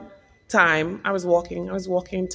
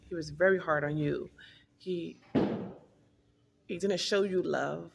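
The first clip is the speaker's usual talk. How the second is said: much softer, fading voice; hushed; 9 dB quieter than usual. Real, not acted. Recalling officer's father, fading to a hush. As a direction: let it fall to a near hush. Emotional softening near end.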